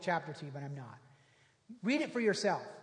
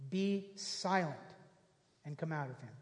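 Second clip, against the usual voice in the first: slow, softer, dropping away; quiet, slow emphatic repeat; slow, soft restatement; 3 semitones lower, 4 dB quieter than usual. Shown as a demonstration. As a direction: let each word sink in slowly. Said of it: "repeated slowly".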